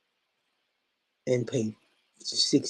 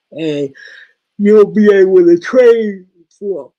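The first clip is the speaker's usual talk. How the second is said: words stretched by a real yawn; 8 semitones higher, 15 dB louder than usual. Real, not acted. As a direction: talk through a big yawn. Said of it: "I'm yawning".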